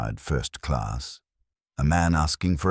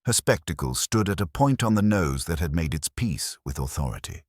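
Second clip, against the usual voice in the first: ordinary delivery, nothing marked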